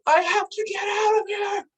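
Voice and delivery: high, loud panicked shout; retold yell. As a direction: yell it out in a high desperate burst.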